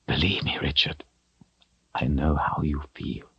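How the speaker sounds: quiet fellow sufferer; soft consolation. A narrator's reading. Zed gently tells Richard he understands, softly.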